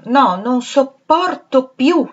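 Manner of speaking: slow, loud and high, breaking down; despairing outburst. A narrator's reading. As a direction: spit out the despair slowly. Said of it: "si diceva". (sfogo disperato, lento e forte)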